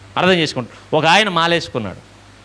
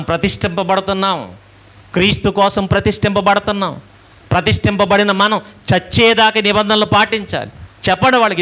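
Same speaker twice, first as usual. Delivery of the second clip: higher pulpit exhortation, building; rising sermon fervor; 4 semitones higher than usual. Acted. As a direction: lift the congregation with conviction.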